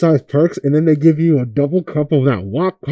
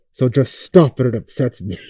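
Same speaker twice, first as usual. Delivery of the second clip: usual speaking voice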